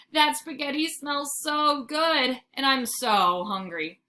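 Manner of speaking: dreamy craving. A narrator's reading. Dog swooning over food smell.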